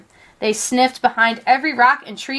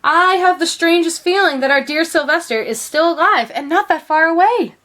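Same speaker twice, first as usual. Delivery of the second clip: higher and louder, hushed wonder building; mysterious excitement; 7 semitones higher, 4 dB louder than usual. A narrator's reading. Mother telling father she feels their son is alive, excited. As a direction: sense he's near, eager and hopeful. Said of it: "felt some mysterious excitement".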